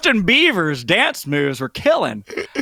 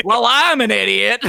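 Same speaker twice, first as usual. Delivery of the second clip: mock dumb admission; 8 semitones higher, 6 dB louder than usual. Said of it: "I was like".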